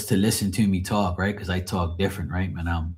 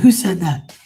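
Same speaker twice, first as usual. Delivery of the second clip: high, loud imitation Scouse challenge; Liverpool accent; 9 semitones higher, 6 dB louder than usual. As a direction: imitate an angry Liverpool foreman, pitched up. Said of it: "he was from Liverpool in England".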